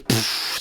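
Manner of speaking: soft puff of breaking liquor bottle; imitated splatter. Describing liquor breaking on the floor, soft splatter noise. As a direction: puff out the sound of a spill. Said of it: "just goes".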